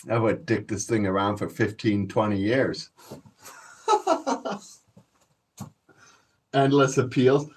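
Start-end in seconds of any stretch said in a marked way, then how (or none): 3.9-4.6 a sudden high cackle of glee; gleeful laugh. Real, not acted. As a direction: burst out laughing at your own scheme. Cackling over his court tricks.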